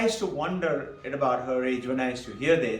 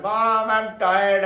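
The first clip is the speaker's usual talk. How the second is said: slow, louder, drawn-out mock whine; whining child; 4 semitones higher, 6 dB louder, much slower than usual. Children to Mom about reading, whining. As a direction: drag it out like a complaining child.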